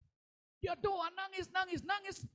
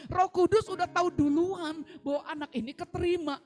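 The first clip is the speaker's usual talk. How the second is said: loud, higher, jubilant sermon reveal; triumphant preaching; 4 semitones higher, 7 dB louder than usual. Real, not acted. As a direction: proclaim the miracle with full force. Preaching climax.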